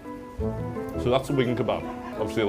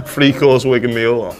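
excited; 8 dB louder, much slower than usual. Real, not acted. Delighted by the big meal.

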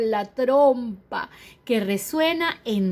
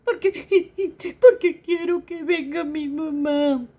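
put-on whiny baby; 5 semitones higher than usual. Baby pleads for mother.

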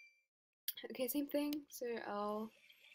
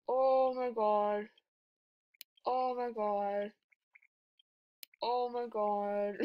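awed oh my god; 7 dB louder, much slower than usual. Real, not acted. Stunned by the rare item.

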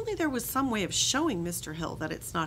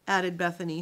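usual speaking voice

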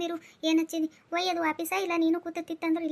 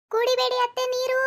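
put-on much higher, brighter young woman's voice; daughter-in-law voice; 8 semitones higher, 4 dB louder than usual. Daughter-in-law stops mother-in-law from drinking, higher young voice. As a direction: burst in to stop her.

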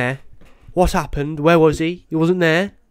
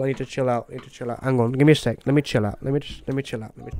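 fading to much softer self-calming mutter; calming himself; 3 semitones lower, 10 dB quieter, much faster than usual. Real, not acted. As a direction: talk yourself down from anger. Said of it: "I'm getting angry".

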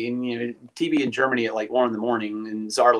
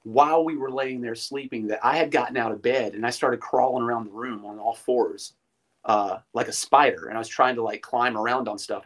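ordinary delivery

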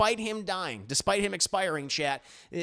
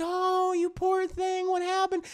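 high-pitched pitying coo; cooing sympathy; 13 semitones higher than usual. Acted. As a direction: play a woman fussing over a poor animal. Arena pities the pregnant bird.

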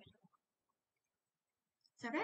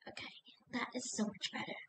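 whispered; 8 dB quieter than usual. Real, not acted. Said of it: "unvoiced whisper".

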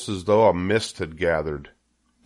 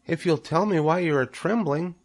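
high, tiny creature voice; little spider; 10 semitones higher than usual. A narrator's reading. First spider bargains with Wilbur, high little voice. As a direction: answer small and pitched way up. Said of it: "replied the first little spider".

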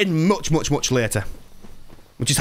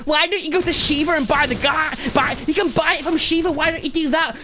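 high-pitched mimicry of nagging chat; exasperated mock-quote in a high voice; mocking backseater; 9 semitones higher than usual. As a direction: imitate an annoying know-it-all viewer, pitched way up. Mimics backseating chat during rant.

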